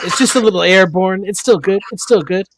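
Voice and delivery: high, loud cartoon dad voice; Homer voice. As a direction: insist cheerfully like a greedy cartoon dad. Quoting Homer eating dirty food.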